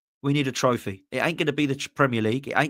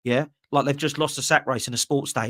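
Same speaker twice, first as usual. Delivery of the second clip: normal talking voice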